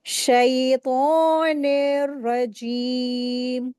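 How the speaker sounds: slow, drawn शैतानिर रजीम, heavy रा; heavy ता in शैतानिर, ई of रजीम drawn two counts; correct tajweed. Shown as a demonstration. Tajweed teacher models the phrase for students, slow. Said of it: "इसको heavy करके पढ़ा जाएगा".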